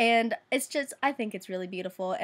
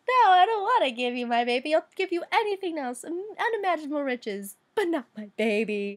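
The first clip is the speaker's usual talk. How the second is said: a louder, higher mock plea; pleading mother; 5 semitones higher, 4 dB louder than usual. Queen begging the imp to spare her baby, mock pleading. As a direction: beg to keep the baby, hammed-up. Girl refusing to give baby.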